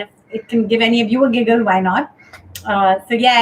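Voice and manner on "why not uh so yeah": usual speaking voice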